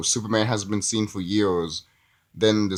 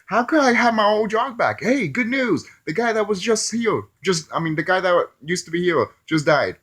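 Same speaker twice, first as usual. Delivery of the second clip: much higher, perky announcing voice; mock cheery news; 8 semitones higher than usual. Clark getting his job back, high perky mock cheer. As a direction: deliver the death as happy news. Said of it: "It's like".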